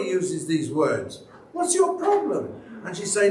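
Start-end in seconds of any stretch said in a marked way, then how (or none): 1.5-2.6 high, mocking challenge; scoffing retort. Young husband mocking his wife's objection, high. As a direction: toss it back at her mockingly.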